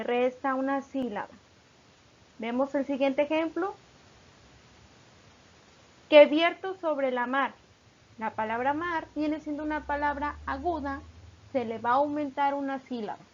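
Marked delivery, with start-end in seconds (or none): 6.1-7.5 measured, careful recitation of a line of poetry; slow verse reading. Shown as a demonstration. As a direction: read the verse slowly and a bit louder. Teacher reads example verse.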